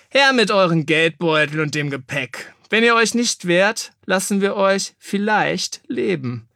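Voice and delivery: a hint of a gloating bandit; threatening robber, drawn-out last words; robber's threat. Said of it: "sagte ein Mann".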